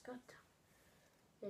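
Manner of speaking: breathless whispered swear; whispered curse. Real, not acted. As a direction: whisper it under your breath. Unvoiced after wrong answer.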